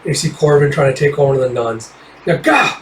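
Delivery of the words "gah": sharp high cry of effort; straining grunt. Acted. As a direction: grunt as you grapple the demon. Warrior struggles with demon.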